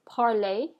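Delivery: "parler" as "par-lay", final R silent; French pronunciation. Shown as a demonstration. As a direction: model "parler" with its ending rhyming with play. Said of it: "pronounce this last example with me".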